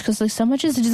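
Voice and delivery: normal talking voice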